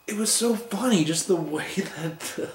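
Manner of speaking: tickled, laughter spilling into the words; real amusement. Real, not acted. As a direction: laugh as you recall a funny bit. Amused recalling the frog gag.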